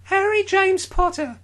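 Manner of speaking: very high squeaky Harry. Acted. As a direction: squeak the name out proudly. Harry introduces himself.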